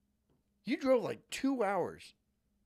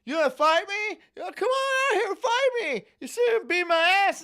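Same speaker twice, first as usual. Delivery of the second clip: loud, much higher mock yell of a challenge; retold shouting; 8 dB louder than usual. Man shouting from across the street to pick a fight, loud high mock yell. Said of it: "he was shouting across".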